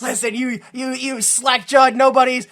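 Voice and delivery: trash talk the crowd like a heel manager; wrestling manager trash talk. Pretend. Manager trash talking the rival, loud heel rant. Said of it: "you just be the mouthpiece".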